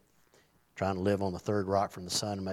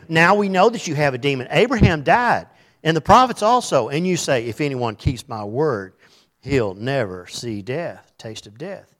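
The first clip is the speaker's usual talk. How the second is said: loud, higher preaching of the accusers' words; preaching at full force; 4 semitones higher, 8 dB louder than usual. Acted. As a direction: proclaim the crowd's charge with force.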